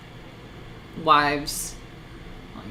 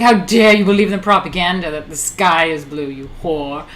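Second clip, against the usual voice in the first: mock outraged commenter; 8 dB louder than usual. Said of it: "they'd be like".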